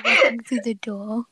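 words through laughter; laughing. Real, not acted. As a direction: keep laughing while reading.

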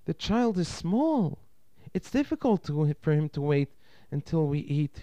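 high-pitched, reasoning wife; explaining aunt. A narrator's reading. Wife excuses the small child to her husband, reasoning.

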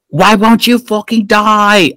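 mock British accent, slow and high-pitched; hammed-up British villain, high and drawn out. Lady Eboshi shooting at the god, mock British accent. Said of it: "I don't know why she's British".

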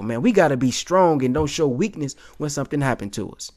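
normal talking voice

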